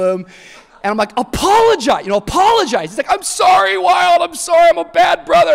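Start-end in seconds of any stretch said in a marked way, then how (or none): 1.2-2.9 high, loud insistent order; exasperated parent calling out a command; demanding dad. Retold or imitated. Dad ministering to his dunking son, demanding. 3.1-5.5 put-on high, loud wailed kid apology; hammed-up panicky little brother; frantic apology. Boy made to apologize to his brother, frantic. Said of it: "and he's apologizing".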